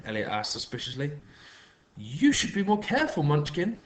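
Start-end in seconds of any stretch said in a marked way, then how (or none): 2.0-3.8 high, sing-song sweetness; trilled. A narrator's reading. Sugary neighbour scolds the boy in a high trill. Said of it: "trilled Patricia".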